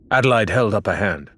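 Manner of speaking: usual speaking voice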